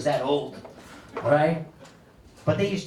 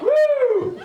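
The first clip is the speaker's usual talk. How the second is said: high, loud whoop; mock high; 5 dB louder than usual. Smoker after the first hit, loud high whoop. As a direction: whoop like the hit just landed.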